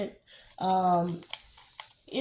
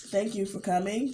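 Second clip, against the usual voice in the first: ordinary talk, nothing marked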